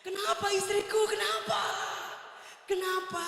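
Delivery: high, overdone worried asking; husband's hammed-up show of concern; fake concern. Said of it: "dengan sok-sok perhatian nanya".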